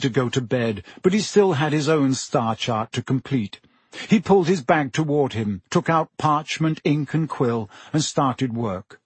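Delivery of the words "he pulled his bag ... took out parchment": ordinary delivery, nothing marked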